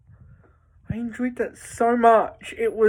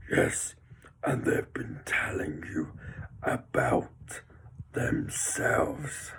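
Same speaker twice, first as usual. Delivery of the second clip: soft, breathy unseen voice; mysterious whisper; 12 dB quieter, slower than usual. Retold or imitated. A hidden voice answering Spider-Man, eerie whisper. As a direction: whisper it like a secret from the dark.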